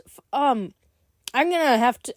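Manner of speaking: ordinary talk, nothing marked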